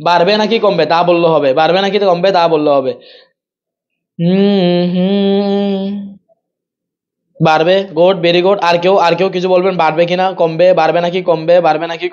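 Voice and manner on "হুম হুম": long, level "hmm"; drawn-out humming. Real, not acted. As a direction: hum steadily while waiting for an answer. Waiting for students' answer. (উত্তরের অপেক্ষায় টানা হুম)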